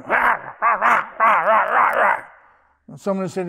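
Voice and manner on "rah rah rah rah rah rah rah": 1 imitated chihuahua yapping. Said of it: "they were going".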